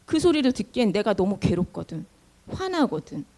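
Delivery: soft, downcast grumbling; weary, low grumble. Acted. Suffering Israelites explain why they won't listen, weary and subdued. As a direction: grumble under your breath, worn down.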